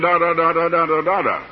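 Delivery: imitating reading that carries no meaning; meaningless droning. Husband recalling wife's rapid Bible reading, imitated meaningless drone. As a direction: run off monotone syllables like words going right past you. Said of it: "It was just like saying".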